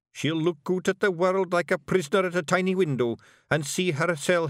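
high, slow, broad Scots pictsie voice; a wee blue man laying out a grim plan; Scottish Feegle chief. A narrator's reading. Rob Anybody speaking to Feegles.

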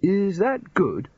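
slow, high-pitched query; uncertain, high and drawn out; unsure Rincewind. A narrator's reading. Rincewind unsure of his feat.

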